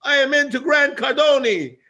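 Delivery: high, loud put-on gushing fan; excited fan voice. Stranger in Marbella gushing over Grant, high and loud. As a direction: mimic a starstruck stranger gushing. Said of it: "He goes".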